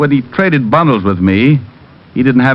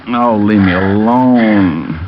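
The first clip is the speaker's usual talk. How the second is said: groggy mumble; much slower than usual. Acted. Groggy after being knocked out.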